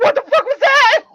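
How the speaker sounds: high laughing disbelief; shocked, laughing. Retold or imitated. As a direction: gasp it out through laughter. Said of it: "They're just like".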